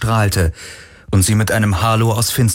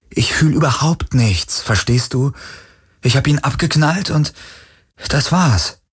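higher, strained confession; agitated outburst; 6 semitones higher than usual. Acted. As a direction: force the words out, upset. Galen bricht heraus. (aufgewühlt, höher herausgestoßen)

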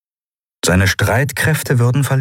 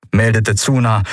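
usual speaking voice

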